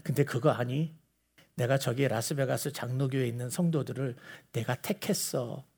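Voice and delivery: very soft, low voice; hushed confiding. Retold or imitated. God telling Jesus whom he chose, hushed and confiding. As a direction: confide it gently, barely above a murmur.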